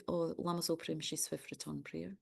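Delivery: normal talking voice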